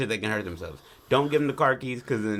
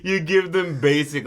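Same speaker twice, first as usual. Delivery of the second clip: high, loud burst of laughter; laughing; 8 semitones higher, 11 dB louder, much slower than usual. Real, not acted. Friend laughs while joking about parents, loud laughter. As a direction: crack up mid-sentence.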